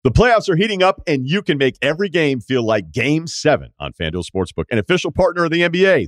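upbeat, high, loud ad read. Acted. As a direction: sell it like a sports ad. Reads a sponsor ad.

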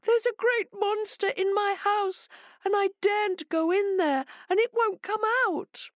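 very high, squeaky wail; crying hare. Acted. Hare tells Jackal of the monster, crying. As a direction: whimper it high as the distressed hare. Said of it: "cried Hare".

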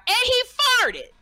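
very high, loud mocking yell; screamed heckle. Heckler in the front row screaming at the comic, loud and high. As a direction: blurt it out over the punchline. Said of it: "the man screamed out".

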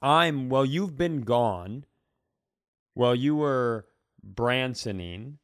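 ordinary talk, nothing marked

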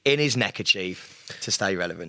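low, slow, hushed announcer; mock segment intro. Host introducing Pete's antics, mock-solemn.

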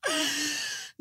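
high-pitched wheezy laugh; high giggle. Real, not acted. Host still amused by the meme, high giggling laugh. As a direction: let out a high trailing laugh.

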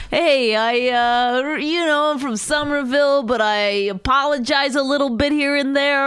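high, loud mock tough guy; mocking imitation. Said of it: "do you mind if I say it like this".

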